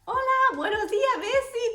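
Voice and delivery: saludo muy agudo y alegre; todo feliz. Retold or imitated. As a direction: saluda radiante, con ganas de encajar. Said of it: "todo feliz". (saludo feliz y agudo a la vendedora)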